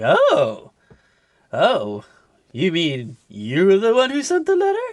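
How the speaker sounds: high, rising, flirtatious realization; intrigued mock coo. Acting out Missus Slocum learning who sent the letter, flirtatiously intrigued. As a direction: coo it with pleased, exaggerated surprise. Slocum delighted at supposed admirer.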